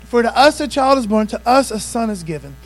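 loud, ringing scripture declaration; preacher's full-voice proclamation; preached scripture. Acted. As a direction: proclaim it to the congregation.

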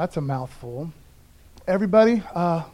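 ordinary talk

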